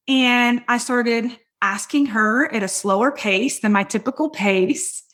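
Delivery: ordinary delivery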